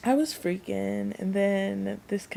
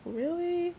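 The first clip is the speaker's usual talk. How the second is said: surprised really; 11 semitones higher than usual. Retold or imitated. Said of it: "And he was like".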